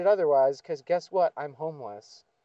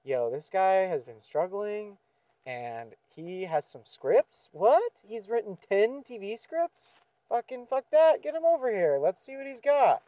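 high, excited hype voice; pitched-up gushing discoverer; excited producer; 4 semitones higher than usual. Pretend. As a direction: sell it like a thrilled studio boss. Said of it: "I just want someone to be like".

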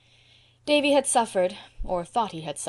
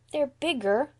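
put-on high, timid child voice; small plaintive voice; little girl; 7 semitones higher, slower than usual. A narrator's reading. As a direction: object meekly. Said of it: "said Dora".

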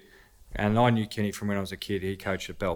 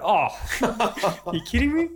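high, laughing mock disbelief; 11 semitones higher, much slower than usual. Player told he's dropped, laughing disbelief. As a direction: squeal it out in laughing shock. Retold shock at being dropped.

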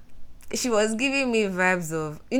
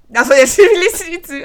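laughing; 11 semitones higher, 13 dB louder, faster than usual. Real, not acted. Laughing at own point.

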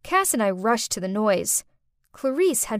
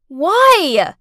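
high, loud furious why; scream; 6 semitones higher, 10 dB louder than usual. Acted. Stepmother screams at the lying girl, high and loud. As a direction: scream it right in her face. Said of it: "I screamed at Clarice's face".

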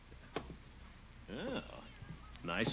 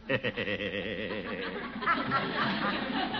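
smug chuckle; 5 semitones lower than usual. Acted. Gildersleeve pleased with badge.

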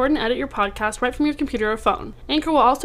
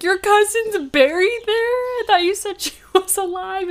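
shocked; 8 semitones higher than usual. Retold or imitated. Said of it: "I was like".

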